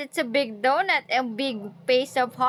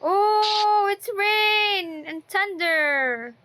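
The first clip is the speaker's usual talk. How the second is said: excited; 7 semitones higher, much slower than usual. Real, not acted. Excited to toddler.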